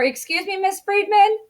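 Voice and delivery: "Excuse me, Miss Friedman" high, climbing at the end; questioning call for attention. Shown as a demonstration. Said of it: "trying to get my attention".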